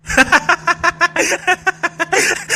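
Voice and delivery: long high-pitched cackle. Acted. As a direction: burst into a high, teasing laugh. Mocks the stuck rider, laughing. (ठहाका मार के हँसी)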